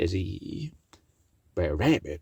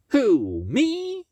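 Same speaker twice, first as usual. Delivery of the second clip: mock innocent; 4 dB louder than usual. Brer Rabbit answering Miss Meadows, mock-innocent high. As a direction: voice leaping way up on me.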